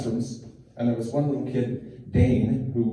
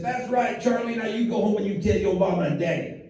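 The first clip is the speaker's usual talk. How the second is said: folksy Southern twang, pitched up; Southern dog puppet; 7 semitones higher than usual. Acted. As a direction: praise a child as a Southern hound puppet. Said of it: "kind of a redneck Southern drawl".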